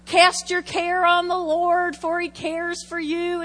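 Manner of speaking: scripture recitation. Acted. Preacher reciting a familiar verse to her congregation, slow declamation. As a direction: declaim the verse, stretching the key words. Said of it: "did anybody read this scripture before?".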